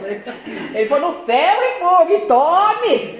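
half-playful imitation of a blunt, forceful rebuke; mock forceful pastor. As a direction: hammer it home like the pastor did, lightly mocked. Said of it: "Mais ou menos assim". (imitando de leve um pastor duro e enérgico)

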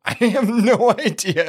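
real amused laugh while speaking. Real, not acted. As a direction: give up on it with a laugh. Host laughs at own confusion.

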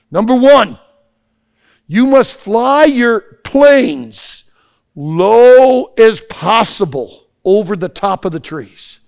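loud, high, very slow proclamation; commanding chief. Acted. Gurkha chief giving the commander his first condition, loud and commanding. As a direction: stretch each word like a chief issuing orders.

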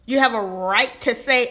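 ordinary talk

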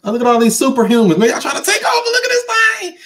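very high, thrilled squeaky voice; excited squeal. Real, not acted. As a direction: squeal with delight at the crowd. Amazed at crowded live chat.

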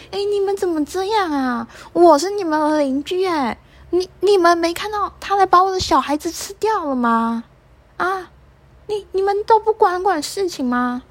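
high, indignant bird voice crying out to the neighbours; shrill-pitched outrage, demanding help; angry mother swallow. Acted. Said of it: "燕子妈妈很生气的看着". (燕子妈妈高声气愤地质问)

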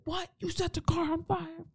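hammed-up outraged partner; harsh partner yelling. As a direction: play the nasty partner berating her. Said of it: "someone else might be like".

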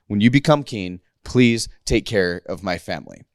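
low, measured plea with spaced-out words. Retold or imitated. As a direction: separate "please", "take care" and "of my family" deliberately. Jonathan insists after David objects.

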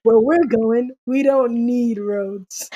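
slowed right down for the guesser. Shown as a demonstration. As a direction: say it slowly, stretching the last phrase. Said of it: "I'm gonna say it slow this time".

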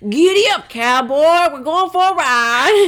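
loud, high, stretched cowboy southern; southern western accent. Shown as a demonstration. Taking a turn in the challenge, big southern holler. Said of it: "let's do a southern".

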